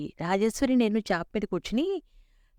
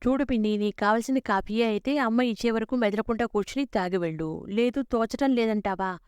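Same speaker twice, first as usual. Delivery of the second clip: usual speaking voice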